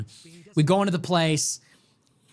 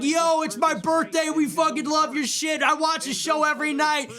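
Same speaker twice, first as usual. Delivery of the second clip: excited fan shouting; 8 semitones higher than usual. Retold or imitated. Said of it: "They're like".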